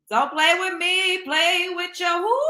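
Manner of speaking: mock warning. Teasing viewers with a loud slow mock threat. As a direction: drag it out like a playful threat.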